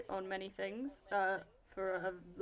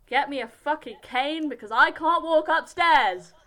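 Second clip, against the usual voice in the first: loud, higher mock grumble about her age; mock old complaint; 4 semitones higher, 13 dB louder than usual. Teen jokes to her cohost that she's old, loud mock grumble. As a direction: bellow it like an old woman who can't climb stairs. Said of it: "I'm old".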